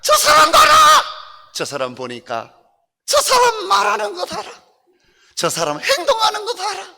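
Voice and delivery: high, amazed onlooker; onlooker in puppet voice. Acted. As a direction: exclaim like a stunned passerby. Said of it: "누가 저분을 보면". (놀란 구경꾼 같은 높은 목소리)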